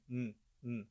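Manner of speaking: soft N hum; N sound. Shown as a demonstration. Teacher demonstrates the N sound quietly. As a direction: hum a clear N. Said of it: "Quando tem o som de N, faz assim".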